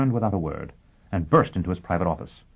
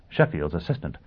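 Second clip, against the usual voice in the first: ordinary delivery, nothing marked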